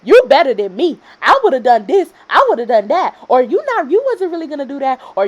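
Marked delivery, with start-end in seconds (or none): 0.0-3.1 louder mock boasting; mocking commenters. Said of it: "the think piecers always come and be like". 3.4-5.0 higher-pitched mock accusation; mocking commenters. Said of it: "the think piecers always come and be like".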